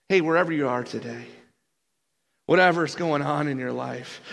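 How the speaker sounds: ordinary talk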